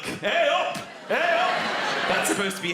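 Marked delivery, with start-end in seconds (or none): 0.0-1.5 loud, high Yorkshire "Hey up!"; Yorkshire greeting. Pretend. Comedian greeting Leeds crowd, loud Yorkshire accent. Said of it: "vague Yorkshire accent".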